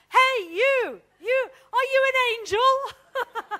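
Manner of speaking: loud, high-pitched call to a passer-by; acted-out shout from a distance; yelling out. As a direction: holler it out, stretching the words. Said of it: "he yells out".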